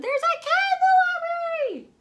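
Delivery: very high and loud panicked shout; shrieking alarm. A narrator's reading. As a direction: yell it across the room. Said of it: "Someone shouted".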